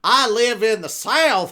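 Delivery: loud, slow Southern drawl; mock Southern accent. Joking about living in the South, Southern drawl.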